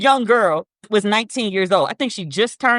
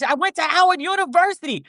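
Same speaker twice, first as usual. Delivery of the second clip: imitating Letitia James; 7 semitones higher than usual. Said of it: "she was like".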